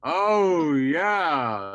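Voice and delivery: loud, high, drawn-out remembering; delighted recognition. Retold or imitated. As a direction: act out Dad suddenly recalling it, stretching the words. Acting Dad's recognition of the show.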